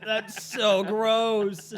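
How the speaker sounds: high, drawn-out disgust; grossed out. Real, not acted. Friend reacting to a crude joke, grossed out. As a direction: stretch it out in amused revulsion.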